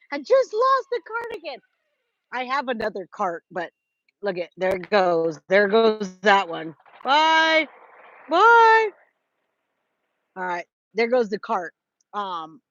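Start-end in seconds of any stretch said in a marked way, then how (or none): 0.1-1.6 mock high repeat. 7.0-8.9 sing-song high bye, rising on the second one; playful high goodbye. Pretend. Waving goodbye to the rolling cart, in a playful high voice.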